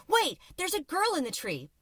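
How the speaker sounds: shouting man. Acted. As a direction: yell a warning to the crowd. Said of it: "a man shouted".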